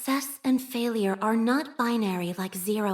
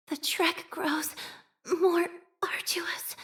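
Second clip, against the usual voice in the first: slow, higher, half-whispered strain; 7 semitones higher, slower than usual. Acted. Wounded operator in battle, slow and strained. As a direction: push through the hardship.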